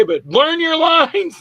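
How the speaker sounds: very high, stretched-out holler; imitated yell. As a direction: burst from reassurance into a slow mock bellow. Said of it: "I don't mean to yell at you".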